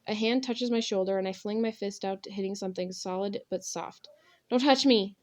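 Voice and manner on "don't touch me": higher, loud distressed cry; sobbed outburst. A narrator's reading. Girl recoiling from a hand on her shoulder, sobbing. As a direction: burst out against the touch, upset. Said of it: "I sob".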